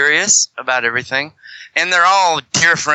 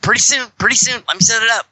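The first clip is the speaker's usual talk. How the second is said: high, quick brush-off; mock stalling; fobbing him off; 10 semitones higher, much faster than usual. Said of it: "I'm like".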